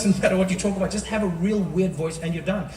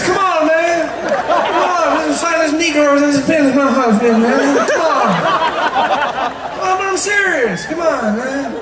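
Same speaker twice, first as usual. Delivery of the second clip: high, loud, exasperated protest; Joe Biden, insisting; 6 semitones higher, 7 dB louder, slower than usual. Acted. President insisting he is serious, high insistent voice. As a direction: plead to be believed, pitched way up. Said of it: "Joe Biden, he doesn't speak normally".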